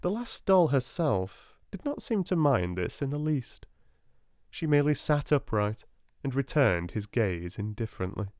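usual speaking voice

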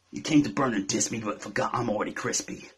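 low wrestling promo voice. Acted. Rapper embracing the wrestler jibe, wrestling promo. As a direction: drop it low like a wrestler's threat. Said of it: "so here's a fucking wrestling promo".

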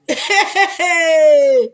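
loud, high burst of laughter. Real, not acted. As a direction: laugh out loud, ending in a long high tail. Amused while reading live comments.

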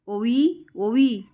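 light narrated call for someone. A narrator's reading. Charu looks for Ovi in her room, calling out. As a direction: call the name, drawn up as if into another room. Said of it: "Charu आवाज देते".

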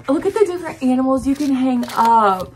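ordinary delivery

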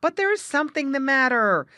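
high, loud protest; insistent. A narrator's reading. Jerry contradicts Grandfather Frog, insistent. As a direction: press the point urgently. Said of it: "insisted Jerry Muskrat".

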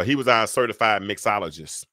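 normal talking voice

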